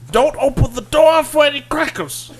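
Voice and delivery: high, loud mock yell; sitcom dad voice. Continuing comedic impression.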